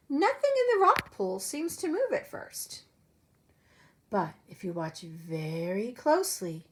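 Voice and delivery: lively storybook read-aloud voice; animated picture-book narration; reading to children. Acted. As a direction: read it like story time for little kids. Teacher reads picture book aloud.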